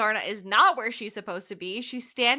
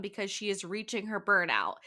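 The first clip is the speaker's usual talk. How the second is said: normal talking voice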